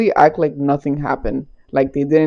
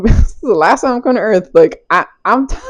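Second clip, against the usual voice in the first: words breaking into laughter; real giggles cutting into the words; laughing while talking; 4 semitones higher than usual. Real, not acted. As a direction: crack up mid-sentence. Cracks up retelling own reply.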